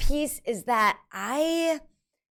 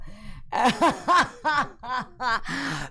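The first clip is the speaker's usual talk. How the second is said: long peal of laughter; rolling laugh, lifted in pitch; real laugh; 4 semitones higher than usual. Real, not acted. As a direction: crack up at yourself. Laughs at irony of own voice.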